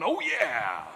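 Kool-Aid Man. Retold or imitated. Kool-Aid Man crashing the film's finale with a loud boom. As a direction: burst in with a big cheery boom. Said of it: "the Kool-Aid man busting out".

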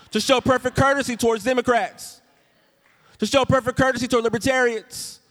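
high, loud preacher's build, slowing at the end; full-force preaching. Acted. Preacher pressing the verse on the congregation, full force. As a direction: ring it out to the congregation.

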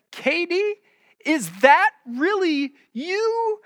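high, slow granny voice; a sweet old granny asking, drawn out; old grandmother voice. Retold or imitated. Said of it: "in their best old grandmother voices".

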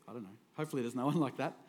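usual speaking voice

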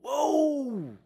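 high exclaimed whoa; mock amazement. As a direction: act astonished at the verse. Reacting to big statement.

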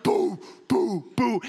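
loud imitated banging "boo"; knock sounds. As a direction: mimic a fist pounding the door. Imitating fist smashing door.

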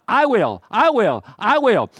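each "I will" high and punched, falling; preacher driving home a repeated vow; emphatic promise. Acted. Voicing God's emphatic promise.

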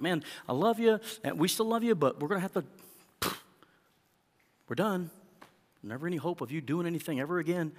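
hammed-up preachy rejection; mock church dismissal. Pastor mocking how churches cast out fallen people, mock dismissal.

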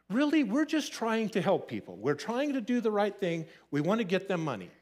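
retold exasperation, starting high and easing down; frustrated. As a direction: plead your case in mild frustration, pitch up. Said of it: "now I'm getting frustrated".

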